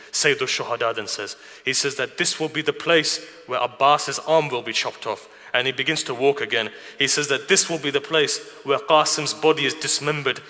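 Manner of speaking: ordinary talk, nothing marked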